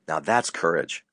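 ordinary talk

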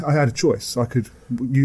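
normal talking voice

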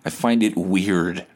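ordinary talk, nothing marked

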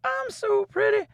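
very high prissy pageant queen. Acted. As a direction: preen like a beauty queen. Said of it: "pageant queens".

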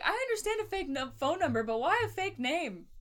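high, incredulous sitcom imitation; mock bafflement. Sitcom woman questioning why anyone fakes a name, mock bafflement. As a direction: act puzzled, pitched well up.

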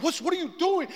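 high-pitched dismayed wife; imitating alarmed wife. Wife questions out-of-control husband, high alarmed imitation.

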